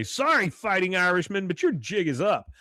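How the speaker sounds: high, loud announced taunt; mock taunt. Connie confronting the leprechaun, mock taunting call. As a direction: call it out as a playful threat.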